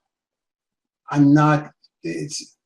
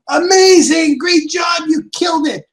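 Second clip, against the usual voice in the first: mock cheering praise; 10 semitones higher than usual. Boss gushing praise at salesman. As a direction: gush over the sale like a proud boss.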